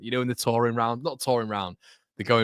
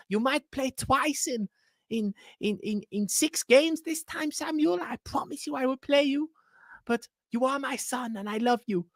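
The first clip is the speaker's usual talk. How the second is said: high-pitched cooing manager with a mock German accent; doting Daniel Farke impression. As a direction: coo over him like a doting dad. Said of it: "I enjoy doing his accent too much".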